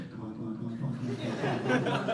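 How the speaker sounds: soft, pleading come on under the breath; willing the plane to climb, softly pleading; desperate muttering. Retold or imitated. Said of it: "going, like".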